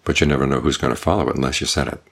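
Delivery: ordinary talk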